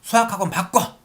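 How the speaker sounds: upset mother barking an order; mom yelling. Retold or imitated. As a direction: scold the kid over a bad math score. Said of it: "당연히 엄마께서".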